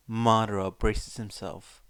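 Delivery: usual speaking voice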